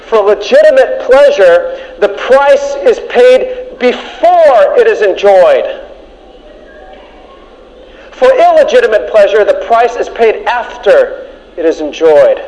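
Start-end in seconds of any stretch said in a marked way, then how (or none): 0.0-11.3 each word spaced out, slow; slow and deliberate. Shown as a demonstration. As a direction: drive the point home slowly. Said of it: "listen carefully now, because this is number one".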